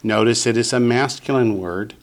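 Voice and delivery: ordinary delivery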